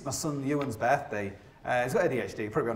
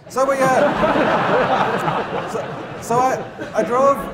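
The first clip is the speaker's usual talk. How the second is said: laughing; 8 semitones higher, 7 dB louder, much slower than usual. Real, not acted. Laughing at his own story.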